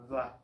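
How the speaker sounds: "vla" with a real v, lip on teeth; "vla" with buzzing v, not b; Portuguese "vla". Shown as a demonstration. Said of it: "sonido de".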